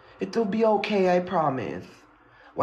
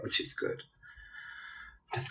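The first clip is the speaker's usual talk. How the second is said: whisper; 15 dB quieter than usual. Real, not acted. Filming in the dark at night.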